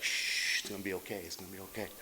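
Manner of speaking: put-on soft, fading hush like rocking a baby; murmured comfort to a frightened man; soothing hush. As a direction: lull him down to a near-whisper. Girlfriend rocking and soothing him.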